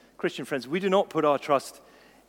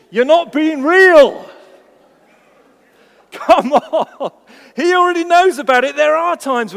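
much higher, louder, slow exclamation; loud exclaim; 12 semitones higher, 16 dB louder than usual. Real, not acted. Preacher calling out fake prayer, loud and high. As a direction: cry it out to shake them. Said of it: "preacher exclaims at congregation".

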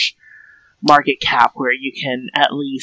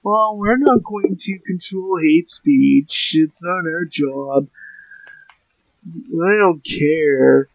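slow, whiny resentful nerd voice; whiny Zuckerberg; 3 semitones higher, slower than usual. Retold or imitated. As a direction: drag it out like a sulky Harvard nerd. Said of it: "the whiniest ... resentful Harvard nerd voice".

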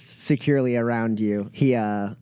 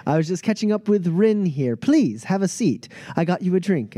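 higher-pitched, gallant host; charming Pep; 6 semitones higher than usual. Acted. Pep offering Elkiri a seat and drink, charming. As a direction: play the attentive charmer.

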